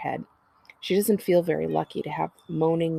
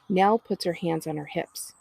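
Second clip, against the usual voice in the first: plain reading voice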